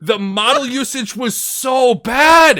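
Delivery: slow, loud outraged rant; exasperated shout. Real, not acted. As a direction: yell it in disbelief. Ranting about movie.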